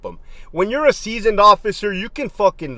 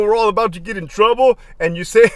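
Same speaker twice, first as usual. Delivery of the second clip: ordinary talk, nothing marked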